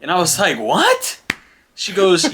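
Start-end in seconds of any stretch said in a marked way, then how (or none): 0.6-1.2 high, rising retold exclamation; taken aback. Dad reacting to his daughter's scolding, incredulous. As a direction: shoot the pitch up in disbelief.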